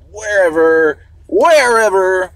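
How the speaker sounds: loud, high, drawn-out repeat; building mock call-out. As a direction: belt out each word, bigger and longer the second time. Mock-dramatic repeat of wherever.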